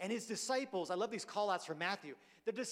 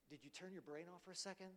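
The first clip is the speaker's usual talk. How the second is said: much softer, deadpan teasing question; dry jab; 13 dB quieter, faster than usual. Retold or imitated. Jesus looking at the rulers in confusion, soft deadpan jab. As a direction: ask it with mock bafflement.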